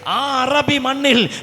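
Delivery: high, loud, slow shouted preaching; fiery sermon climax, drawn out and shouted; preaching at full force. Acted. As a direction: thunder it out from the pulpit.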